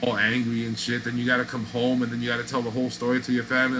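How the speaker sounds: normal talking voice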